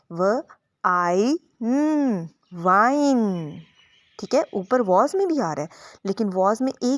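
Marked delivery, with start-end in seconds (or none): 0.1-3.6 व, आई, न, then वाइन drawn out slowly; sounding out wine. Shown as a demonstration. Teacher sounding out wine for parents. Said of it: "इसकी sound अब आएगी आई".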